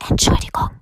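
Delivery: hushed, voiceless apology; giant's whisper. A narrator's reading. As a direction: whisper it shyly through the window. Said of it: "flüsterte der Riese".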